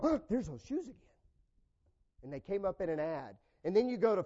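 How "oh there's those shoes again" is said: higher, rising, half-amused "Oh, there's those shoes again"; mock surprise. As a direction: act like you just spotted them again. Noticing an ad.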